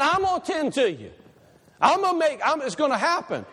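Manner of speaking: a hint of a loving father reassuring; voice of God.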